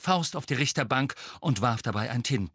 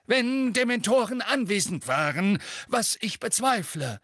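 blustering official; 12 semitones higher than usual. A narrator's reading. Fudge voiced in reading.

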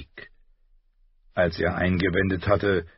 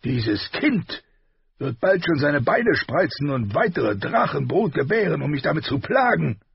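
raised, scornful king voice; warrior king; 8 semitones higher than usual. A narrator's reading. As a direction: sneer it like a war king. Robert voiced in audiobook.

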